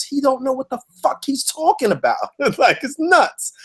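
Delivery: high amused scoff; laughing disbelief. Real, not acted. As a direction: crack up at how absurd it is. Mocking disbelief at the stranger.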